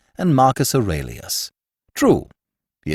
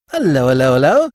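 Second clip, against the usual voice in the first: rising, louder cheery hellos; a bright triple hello; happy greeting; 2 semitones higher, 5 dB louder than usual. A narrator's reading. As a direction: greet him with delight. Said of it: "advancing happily".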